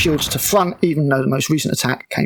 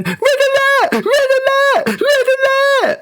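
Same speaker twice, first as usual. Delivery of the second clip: much higher, louder, hammed-up panicked shrieking; panicky terrified cadet; 4 dB louder, slower than usual. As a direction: mimic a terrified cadet running about yelling alarms. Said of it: "credits as terrified cadet".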